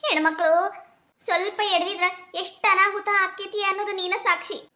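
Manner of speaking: ordinary talk, nothing marked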